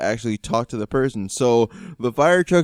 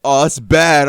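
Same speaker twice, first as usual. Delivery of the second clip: loud mock groaning over damage; exaggerated dismay; 3 semitones higher, 11 dB louder, much slower than usual. Passenger reports damage to dad by phone, exaggerated dismay. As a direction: imitate a friend making it sound far worse. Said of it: "Jung makes it ten times worse than it really is".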